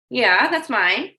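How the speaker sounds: slowed-down, reluctant yeah, that's mine; slow drawn-out yeah. Retold or imitated. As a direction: draw it out slowly as if shrugging. Reluctantly accepting the coffee.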